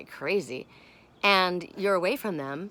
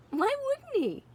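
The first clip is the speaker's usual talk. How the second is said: high squeak. Real, not acted.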